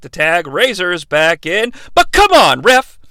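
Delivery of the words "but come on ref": exasperated shout. Acted. Commentary outburst at referee.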